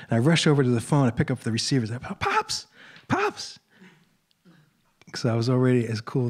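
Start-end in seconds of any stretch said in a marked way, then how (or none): 2.2-3.6 very high, small-voiced call; mock starstruck squeal. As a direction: squeak the name like a flustered fan. Retelling his flustered grab at phone.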